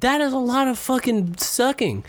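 high disbelieving outburst; incredulous high exclaim. Real, not acted. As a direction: squeak it out in disbelief. Incredulous reaction to ad.

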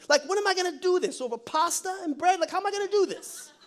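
high-pitched, hammed-up Italian-American plea; Mary, Italian-style. Mary begs the angel for help telling Joey, Italian attitude. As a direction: plead with big Italian attitude. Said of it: "I think she was Italian".

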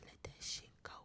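whispering. Real, not acted. Whispered mantra.

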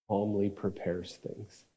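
usual speaking voice

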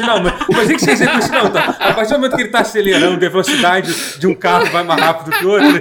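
rising, laughing know-it-all rant; exasperated expert. Retold or imitated. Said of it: "Eu falo assim".

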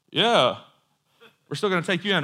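hammed-up unimpressed reply; mock-stubborn arresting crowd. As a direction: brush off the miracle and insist anyway. Arresting crowd acted, unimpressed by miracle.

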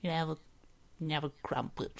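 high little rat voice; squeaky rat voice. Pretend. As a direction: ask like a greedy tiny rat. Rat voice asking crumpet.

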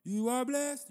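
high, loud preacher cry; loud mock blessing. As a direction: belt it out like a pastor. Mocking church blessing, loud.

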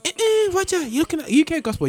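squeaky pitch-jumped imitation of listeners; mocking listeners. Host mimics listeners telling him he is on UK gospel, high and mocking. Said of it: "everyone's going".

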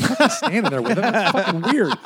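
high, fast, laughing aside; laughing disbelief. Retold or imitated. Telling hosts how he fled the room, high and laughing. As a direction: squeal it out through a laugh. Retelling leaving the awkward room.

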